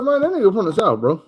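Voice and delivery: high, pointed comeback; retorting. Retold or imitated. Answering West Coast guys with a high pointed retort. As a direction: fire back with the correction. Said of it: "I'm like".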